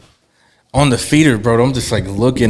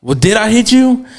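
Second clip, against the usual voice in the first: high, louder mock challenge; indignant retort; 12 semitones higher, 5 dB louder than usual. Driver retelling his reply to the man he almost hit, indignant. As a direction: throw the question back, pitched right up. Retold roadside argument with stranger.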